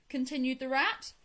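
ordinary delivery, nothing marked

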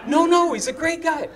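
hammed-up hasty defence; urgent protest. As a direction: leap up in pitch to vouch for him. Defending friend to the agent.

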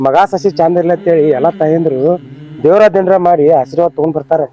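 ordinary talk, nothing marked

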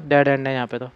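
ordinary talk, nothing marked

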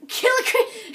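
laughing as she repeats it, breaking off; mock the words through a laugh. Real, not acted. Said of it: "can you believe this guy?".

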